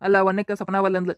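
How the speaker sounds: usual speaking voice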